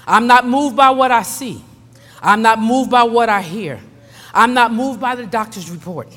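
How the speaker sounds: measured prayer swelling into fervent proclamation; declarative prayer. Real, not acted. Preacher reading a prayer of rest for congregation, solemn then fervent. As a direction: declare each phrase firmly, pausing between.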